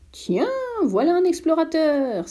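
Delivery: put-on the old geographer spots the prince, exclaiming. A narrator's reading. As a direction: greet him like a long-awaited find. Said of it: "s'écria-t-il".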